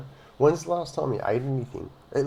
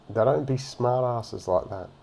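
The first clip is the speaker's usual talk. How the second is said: normal talking voice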